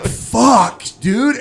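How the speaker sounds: high, loud panicked curse; mock panic. Retelling finding packed bathroom while nauseous, mock panic. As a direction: curse in high, hammed-up alarm. Retold panic before vomiting.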